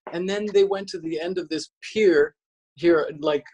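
ordinary delivery

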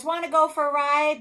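loud, high, eager invitation; storybook horse. A narrator's reading. Horse inviting the spider for a ride, high and loud. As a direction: pitch it up as an animated horse. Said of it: "said the horse".

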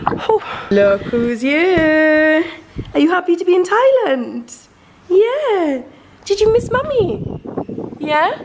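high, slow, loving mum voice. Real, not acted. Mum greeting her daughter in Thailand, high and cooing.

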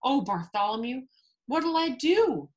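high, helpless storybook plea; acted distressed wail. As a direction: plead in dismay, drawing out the end. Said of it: "the trumpeter wailed".

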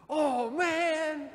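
put-on high, loud "oh man" from the kids; group's surprised cry. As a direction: mimic the stunned youth group. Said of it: "the whole youth group goes".

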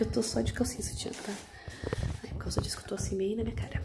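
hushed whisper, barely voiced. Real, not acted. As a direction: whisper it so nobody hears. Half-dressed, keeping voice down.

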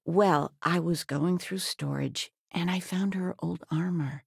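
ordinary talk

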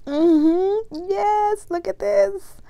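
high, gleeful squeal; excited. Real, not acted. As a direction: bubble over with delight. Excited over gift.

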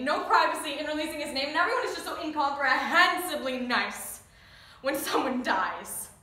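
loud, bitter rant at false sympathy; angry outburst. Acted. Grieving child railing at the media and fake niceness, angry outburst. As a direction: let the grief erupt as loud anger.

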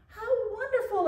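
high, glad exclaim; delighted exclamation. Acted. Creator delighted by the little mouse, exclaiming high. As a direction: lift it with happy surprise. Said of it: "exclaimed the great creator".

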